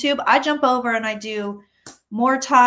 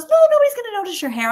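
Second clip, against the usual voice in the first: fast teasing quote; 10 semitones higher, 7 dB louder, much faster than usual. Retold or imitated. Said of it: "She goes".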